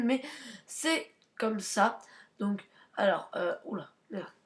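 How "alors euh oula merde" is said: normal talking voice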